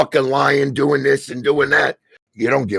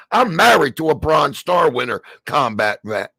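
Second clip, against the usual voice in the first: normal talking voice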